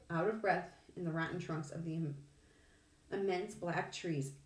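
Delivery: usual speaking voice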